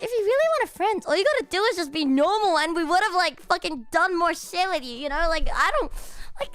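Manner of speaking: mock lecture to the weird girl; imagined comeback. Retold rant at the girl.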